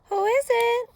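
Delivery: put-on very high, loud old-lady call; Granny calling out. A narrator's reading. Granny answering a knock, calling out. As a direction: sing it out high to the door. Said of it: "called out Granny".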